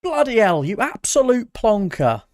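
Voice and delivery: loud, high mock British exclamation; hammed-up British insult shouted for the viewer; extremely British. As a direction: belt it out like an outraged Brit. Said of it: "Here's your extremely British".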